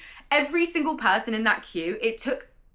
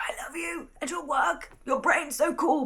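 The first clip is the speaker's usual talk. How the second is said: high, breathless gush of fan love; gushing inner fangirl; 7 semitones higher than usual. Retold or imitated. Fan meeting her favourite author, gushing high and excited. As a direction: let the starstruck fan inside squeal. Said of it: "And inside, I'm like".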